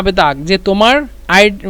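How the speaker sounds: ordinary talk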